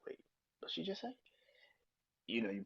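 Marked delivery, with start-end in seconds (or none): 0.1-1.1 startled disbelief. Retold or imitated. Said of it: "I'm like".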